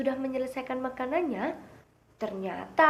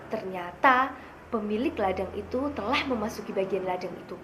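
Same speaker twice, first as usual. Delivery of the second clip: ordinary delivery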